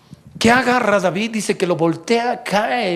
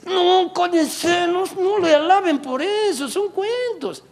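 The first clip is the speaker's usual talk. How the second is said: high, slow hushing devil; a petty devil begging them to stop; high mock demon, silencing the crowd; 12 semitones higher, slower than usual.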